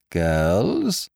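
ordinary delivery, nothing marked

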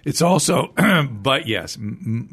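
ordinary delivery

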